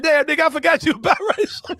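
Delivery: high, loud laughing exclamation; laughing disbelief. Retold or imitated. Teller shouting his shock at his friend, laughing. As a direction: blurt it out through laughter. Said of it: "I said".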